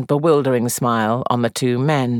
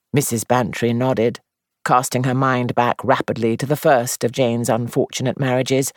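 plain reading voice

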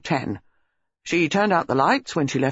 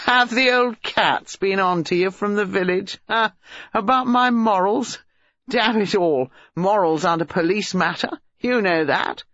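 higher, mocking young man; insolent young man, scornfully amused; 5 semitones higher than usual. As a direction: scoff at the village gossip.

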